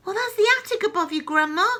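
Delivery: high, loud and fast call; boy hurrying a warning. Acted. George calling up to Grandma, fast and loud. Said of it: "George called out".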